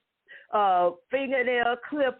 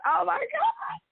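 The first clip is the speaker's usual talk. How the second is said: laughing exclamation; slower than usual. Real, not acted. Neighbors coming out watching.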